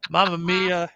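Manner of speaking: jolly Italian pizza chef; hammed-up Italian exclamation; Italian accent. Joking about pizza-making spin.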